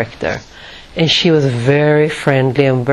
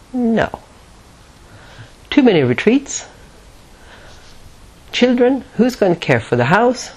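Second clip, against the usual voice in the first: higher, drawn back, resisting objection; sealed-off refusal; 7 semitones higher than usual. Retold or imitated. Thinking of retreats and children, sealed-off refusal. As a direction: shut the idea down, acting it out. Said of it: "sealed off like Teflon".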